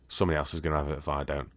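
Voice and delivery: normal talking voice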